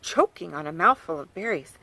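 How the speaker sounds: ordinary delivery, nothing marked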